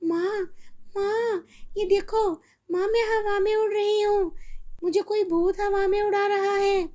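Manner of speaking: put-on high, frightened child crying out; scared girl. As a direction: panic as she floats up. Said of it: "वो डर जाती है".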